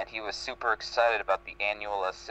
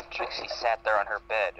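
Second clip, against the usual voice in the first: usual speaking voice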